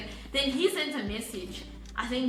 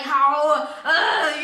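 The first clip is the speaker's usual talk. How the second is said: loud, exasperated retold outrage; angry outburst; 3 semitones higher, 10 dB louder than usual. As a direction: blurt it out loudly, mock-furious. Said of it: "I was so angry".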